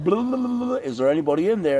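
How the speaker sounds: high, sing-song blah blah taunt; a goofy high taunt, half-laughing; mock teasing. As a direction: needle them in a high silly voice. Teasing poke at someone.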